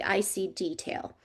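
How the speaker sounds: normal talking voice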